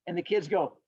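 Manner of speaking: ordinary delivery, nothing marked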